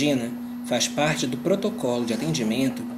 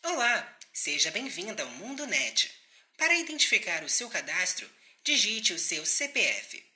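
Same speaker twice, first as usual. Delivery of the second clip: phone menu voice; 3 semitones higher, 5 dB quieter than usual. Acted. Automated call center phone menu.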